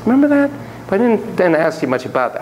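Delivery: ordinary talk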